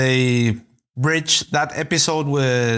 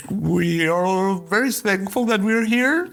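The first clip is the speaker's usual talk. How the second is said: slow, drawn-out mock blessing, rising at the end; Labrador-energy boyfriend; 3 semitones higher, slower than usual. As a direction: imitate an eager, puppyish boyfriend giving grace, slowly. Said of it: "He's like full on Labrador energy".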